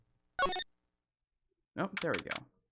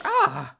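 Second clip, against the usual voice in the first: short high startled shriek; a sudden loud cry of shock; shocked scream; 7 dB louder than usual. Acted. Sudden scream at mother's scroll.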